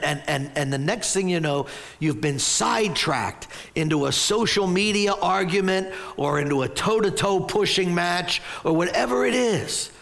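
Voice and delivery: ordinary delivery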